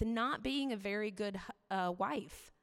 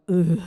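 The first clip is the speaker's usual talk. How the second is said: a loud, exasperated "ugh" groan; frustrated groan; 16 dB louder than usual. Retold or imitated. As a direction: let out a loud groan pressed into the clothes. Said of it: "I was just like".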